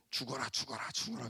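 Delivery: soft, low repeated mutter; muttered curse. Retold or imitated. Wronged person cursing the offender, muttered mock curse. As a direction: mutter it under your breath like a curse. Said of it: "욕을 하잖아요".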